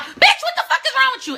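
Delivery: high, loud mock yell; road-rage outburst. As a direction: snap suddenly at a bad driver. Acts snapping at a driver.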